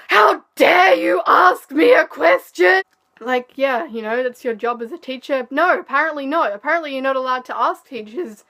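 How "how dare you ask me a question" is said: slow, much louder, higher imitated yell; furious shout. Imitating the teacher screaming at her in class, furious shout. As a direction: bark it out like the enraged teacher. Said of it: "she just lost her absolute shit at me".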